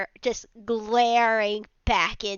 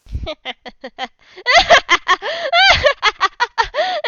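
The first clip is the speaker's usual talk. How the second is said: long, high, loud hysterical cackle; hysterical laughter; 13 semitones higher, 7 dB louder than usual. Acted. Angry figures answering a plea to leave, hysterical laughter. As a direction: laugh wildly in mocking disbelief. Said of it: "they're just laughing hysterically".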